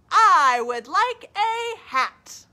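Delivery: high, loud, slow call. Acted. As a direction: call your wish up to the moon. Bear calling to moon.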